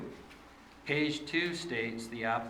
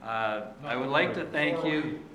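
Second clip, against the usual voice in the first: composes himself and starts over louder; louder, steadier second attempt after stumbling; fresh louder restart; 4 semitones higher, 7 dB louder than usual. Real, not acted. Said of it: "a little nervous".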